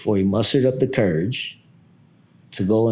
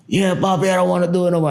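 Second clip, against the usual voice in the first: put-on whiny kid; 10 semitones higher, 4 dB louder, faster than usual. Son whining retold.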